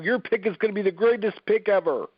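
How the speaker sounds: hammy, boastful Trump send-up; Donald Trump. Acted. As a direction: do a horrible Trump impression. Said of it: "I'm doing a horrible Donald Trump imitation".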